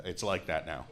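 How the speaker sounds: low, soft flat doctor; deadpan doctor. Retold or imitated. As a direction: drop it low like a bored doctor. Doctor's blunt verdict.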